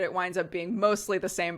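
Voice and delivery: normal talking voice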